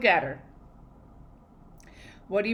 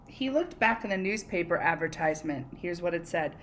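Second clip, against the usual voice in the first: usual speaking voice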